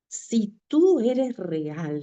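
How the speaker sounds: slow, drawn-out retold challenge to God; re-enacted prayer, slow and insistent; retold plea. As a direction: plead it slowly, stretching the words. Desperate prayer to God in car.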